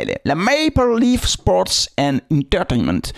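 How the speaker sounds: slow, show-off English accent; exaggerated English pronunciation to impress; put-on English accent. Shown as a demonstration. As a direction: stretch the name out in your best English. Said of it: "il a un super accent et tout".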